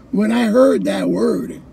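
normal talking voice

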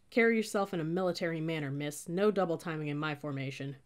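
plain reading voice

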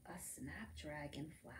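put-on hushed whisper to the children; storyteller's secret whisper. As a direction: breathe the words in a whisper.

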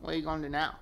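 normal talking voice

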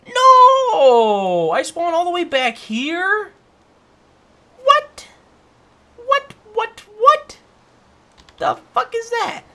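high, loud outraged disbelief; shocked screaming. Real, not acted. Killed and respawned far back.

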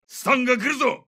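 high, sharp combat warning; urgent warning. Acted. Soldier warns squad of incoming stun, urgent high call. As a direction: alert the squad to incoming danger.

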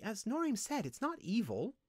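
high-pitched, soothing little voice; calm her fears in a high voice. A narrator's reading. Windling telling slave it's not evil, soothingly. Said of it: "Linthus told her soothingly".

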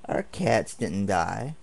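ordinary talk, nothing marked

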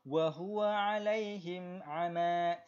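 Quran recitation. Shown as a demonstration. Teacher reciting verse for the class, slow melodic tajwid. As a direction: recite for students to follow.

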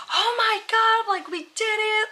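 high, bright burst of joy mimicking him; imitating happy husband. Wife imitating her husband's joy at the finish, high excited. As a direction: squeal it like the thrilled husband. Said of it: "he was just so happy".

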